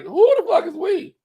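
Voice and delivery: high, loud incredulous yell, mock outrage; a pitch-jumping mock outrage. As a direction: squeal it up high in disbelief. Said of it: "I was like".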